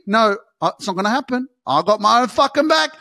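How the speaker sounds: ordinary talk, nothing marked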